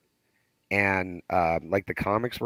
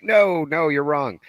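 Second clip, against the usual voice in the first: mock shouting at podcast; 7 semitones higher, 6 dB louder, slower than usual. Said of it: "where you're just like".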